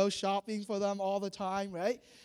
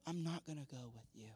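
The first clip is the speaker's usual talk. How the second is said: much softer, lower, ominous; God withdrawing from the people, very soft and low; grave quiet God; 7 semitones lower, 14 dB quieter, faster than usual. Acted. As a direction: drop the voice to a heavy murmur. He repeated that phrase twice.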